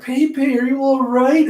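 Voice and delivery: very high, hammed-up fretting; doting high voice. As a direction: fuss over a loved one in falsetto. Ulti fussing over Pei Pei.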